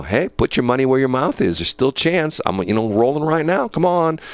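goading. Retold or imitated. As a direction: dangle the bet, pushing her to accept. Goading her into the bet.

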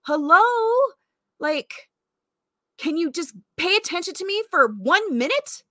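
put-on hurt kid calling out for attention; ignored caller pleading. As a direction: beg an inattentive parent to listen. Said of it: "And I'm over here on the phone like".

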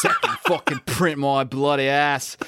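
slow, laughing put-on British accent drifting Australian; mock British accent. Slow laughing mock British accent sending up the author. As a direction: growl it like a grumpy Brit. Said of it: "my British is getting more and more Australian".

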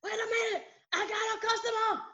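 high shouted aside, then fake-polite greeting; raggedy phone answer. Imitating a home-working employee answering the phone. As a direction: yell off the phone, then switch to the office voice. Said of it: "she answered the phone all raggedy".

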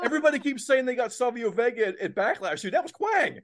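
high, worked-up correction peaking at the end; excited insistence. Real, not acted. Wrestling fan correcting everyone about the cameo, excited and high-pitched. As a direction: set everyone straight, pitch leaping up.

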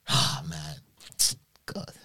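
usual speaking voice

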